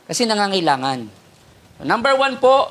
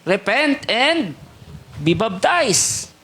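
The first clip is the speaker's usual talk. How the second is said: mock prophet's call; 2 semitones higher, much slower than usual. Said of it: "sinisigaw ni John the Baptist".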